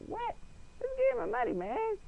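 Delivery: very high whiny demand; demanding the prize money; whiny complaint. Retold or imitated. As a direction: whine for the money. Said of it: "And I was like".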